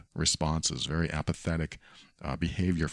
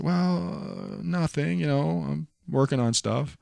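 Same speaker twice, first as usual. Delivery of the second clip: mock girlfriend voice; 9 semitones higher, slower than usual. Boyfriend voicing girlfriend's evasive replies.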